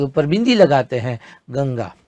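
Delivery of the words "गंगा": normal talking voice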